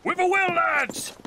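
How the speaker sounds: captain rousing his lads; rallying shout. Acted. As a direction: rouse the crew. Captain urging crew on.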